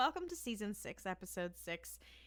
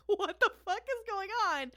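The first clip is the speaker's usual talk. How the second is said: exasperated disbelief; 9 semitones higher, 7 dB louder than usual. Retold or imitated. Waitress reacting to insults.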